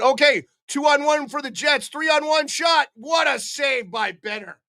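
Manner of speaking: high, fast rising call of the rush; breathless hockey call on a big save; excited play-by-play. Acted. Calls the three on one.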